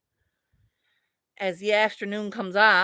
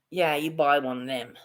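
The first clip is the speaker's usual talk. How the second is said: retold reply; 8 semitones lower than usual. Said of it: "And she's like".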